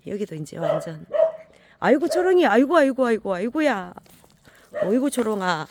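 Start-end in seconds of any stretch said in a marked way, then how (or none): 1.8-5.7 loud, high delighted exclamations, settling down; loud high gushing at a dog, fading; excited greeting. Real, not acted. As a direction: gush over the dog, loud and high, then calm.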